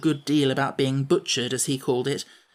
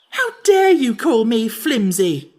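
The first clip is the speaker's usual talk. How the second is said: much higher, louder outraged cry; in a rage; 8 semitones higher, 8 dB louder, slower than usual. A narrator's reading. Jack raging at the Sawhorse's insult, loud outrage. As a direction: explode in disbelief. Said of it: "cried Jack in a rage".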